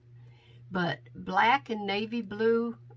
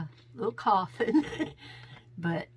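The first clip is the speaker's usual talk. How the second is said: ordinary delivery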